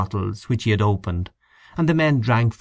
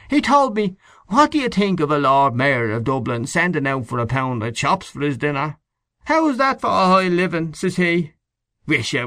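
raised, scandalised gossip; old man mocking the Lord Mayor's stinginess; old caretaker voice; 6 semitones higher than usual. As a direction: relish the outrage of the chops. Old man quotes the porter.

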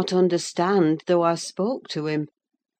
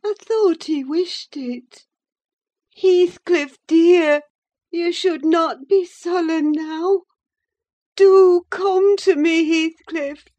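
put-on high, yearning young woman voice; feverish young woman; 12 semitones higher, 5 dB louder than usual. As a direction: plead it like a dying heroine. Catherine voiced in read novel.

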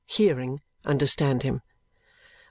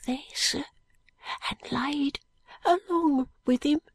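put-on high, weak Cockney boy voice; frail boy, slow and faint; dying street boy; 10 semitones higher, slower than usual. A narrator's reading.

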